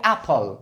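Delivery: एप्पल opened with a short "a" as in "ap-pal", low; correct pronunciation. Shown as a demonstration. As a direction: model एप्पल as "ap-phal", dropping in pitch. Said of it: "इसको क्या कहेंगे". (एप्पल का "आ" से सही उच्चारण)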